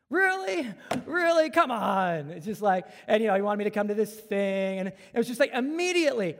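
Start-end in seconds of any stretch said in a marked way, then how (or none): 0.1-2.3 high, loud incredulous repeat; can't believe it was a sales pitch; exasperated disbelief. Retold or imitated.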